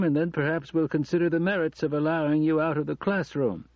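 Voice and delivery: lightly voiced deputy director. A narrator's reading. Deputy director answering a request for field work, mildly dismissive.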